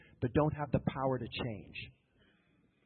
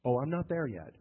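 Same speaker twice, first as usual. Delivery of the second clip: ordinary talk, nothing marked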